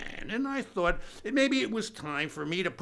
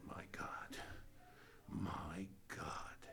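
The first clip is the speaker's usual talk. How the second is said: softer, whispered mock shock; awed whisper; 11 semitones lower, 19 dB quieter, much slower than usual. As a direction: breathe it out in hushed disbelief. Stunned whisper in disbelief.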